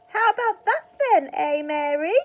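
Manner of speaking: put-on high, loud old woman's shout; shrill old-granny yell from the roof; Grandma shouting. A narrator's reading. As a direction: bellow it down from the rooftop like a cackling granny. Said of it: "Grandma shouted".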